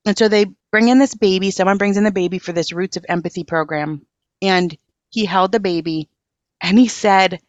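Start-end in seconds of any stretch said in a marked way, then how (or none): none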